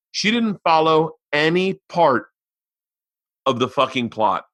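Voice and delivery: ordinary talk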